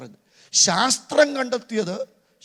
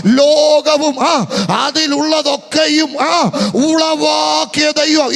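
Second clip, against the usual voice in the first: loud slow shout, words stretched; raised voice; 6 semitones higher, 8 dB louder, much slower than usual. Shown as a demonstration. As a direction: shout it so the congregation follows. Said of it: "ശബ്ദം ഉയർത്തി പറയാൻ". (ശബ്ദം ഉയർത്തി പതുക്കെ വിളിച്ചുപറയുന്നു)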